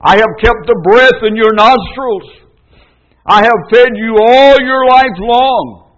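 high, loud and commanding; slowing, thundering divine voice; voice of God. Acted. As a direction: proclaim as God rebuking his people. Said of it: "Jehovah says".

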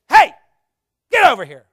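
loud, harsh yell; harsh shout. Shown as a demonstration. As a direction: bark it out harshly. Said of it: "I don't think she was screaming".

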